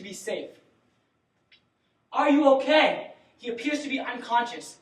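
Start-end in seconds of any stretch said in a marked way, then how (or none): 2.1-3.1 loud call to an unresponsive man; shouting to wake. Acted. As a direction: raise your voice to rouse him. Shouting to check consciousness.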